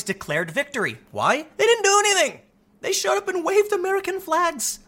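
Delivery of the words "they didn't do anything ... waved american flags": high, sarcastic put-down; mock them in a squeaky high voice. Real, not acted. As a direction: scoff at the idea in a high voice. Mocking the Proud Boys' victory claim.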